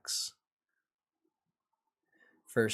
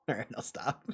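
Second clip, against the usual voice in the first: normal talking voice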